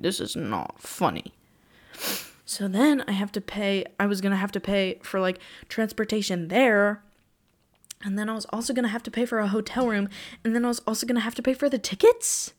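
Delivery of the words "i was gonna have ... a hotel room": ordinary talk, nothing marked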